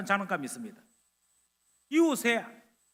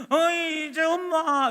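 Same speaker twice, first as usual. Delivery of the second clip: put-on whiny child; 7 semitones higher, 5 dB louder, much slower than usual. Child nagging mother.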